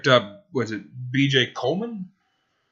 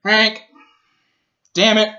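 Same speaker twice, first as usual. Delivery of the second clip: sharp loud calls, pitched way up; yelling at the dog; 10 semitones higher, 8 dB louder than usual. Real, not acted. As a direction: bellow for Hank to get over here.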